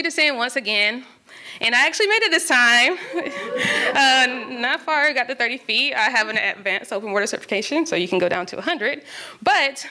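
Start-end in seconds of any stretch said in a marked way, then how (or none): 3.0-3.9 bright rising laugh of relief; high laugh. Real, not acted. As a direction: laugh as the story turns good. Laughs at own success.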